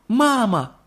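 high, happy call to his mother; boy running to mum; joyful shout. A narrator's reading. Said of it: "закричал Чарли". (радостно кричит маме)

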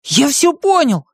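high-pitched cry of realization; excited little hero; sudden exclamation. A narrator's reading. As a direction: burst out in a high, stretched character voice. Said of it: "неожиданно воскликнул Бартоломео".

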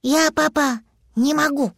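put-on high, childlike admission; little dragon. Stuck little dragon to his papa, high-voiced.

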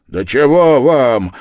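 loud, slow, stretched demand; angry shout. A narrator's reading. Yard-keeper losing patience with Raskolnikov, angry shout. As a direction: shout it with rising temper. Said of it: "крикнул опять дворник, начинавший серьёзно сердиться".

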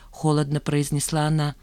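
usual speaking voice

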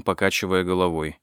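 ordinary delivery, nothing marked

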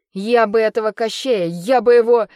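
loud, high furious outburst; angry threat. Acted. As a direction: shout the threat, shaking with rage. Трясинник грозит Кощею, потрясая лапами. (громкая гневная угроза)

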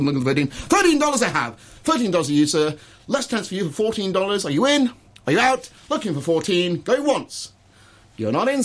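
high, pushing auctioneer calls; going once, going twice, pitched high; auctioneer calling bids. Shown as a demonstration. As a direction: pressure the bidder to come in. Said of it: "should we auction something".

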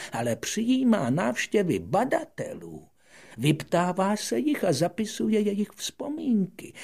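put-on high, loud lecturing old scholar; a self-important old man explaining his trade; old geographer lecturing the little prince, self-important; pompous geographer.

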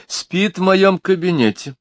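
lightly voiced lady grumbling; complaining lady. A narrator's reading. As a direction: whine about the grumpy colleagues. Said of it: "пожаловалась Миломори".

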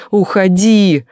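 second time, full yell; shout. Acted. Lena screaming at the young man to leave, loud. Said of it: "ору я".

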